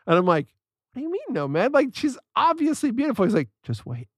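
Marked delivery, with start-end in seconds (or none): 0.9-3.2 high-pitched, disbelieving mock protest; retold high incredulous objection. Retold protest to his roommate. 3.6-4.1 low, soft retold warning; hushed, lower aside in a friend's voice; knowing warning.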